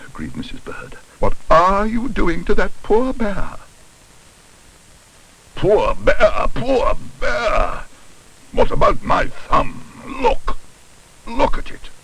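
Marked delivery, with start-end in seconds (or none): 1.2-3.6 high, slow, indignant demand; outraged woman confronting someone. A narrator's reading. Mrs Bird confronts dentist. 5.6-11.9 high, strangled indignation; incredulous wail from the injured party; outraged. A narrator's reading. Bitten dentist protests.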